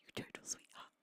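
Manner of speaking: soft whispered thanks. Real, not acted. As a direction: breathe it out tenderly. Thanking a resub.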